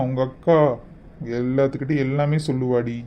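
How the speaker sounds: ordinary talk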